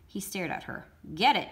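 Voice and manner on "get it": a loud, curt command; sharp order. A narrator's reading. Warden ordering Mister Pendanski, loud and curt. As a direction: bark it at him. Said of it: "she ordered".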